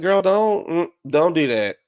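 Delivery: high, loud imitated "don't you start"; warning retort. Sutton to Kyle who yelled at her, imitated high warning.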